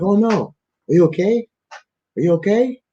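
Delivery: high, sweet worried voice; lightly voiced worried strangers; concerned locals. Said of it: "They're like".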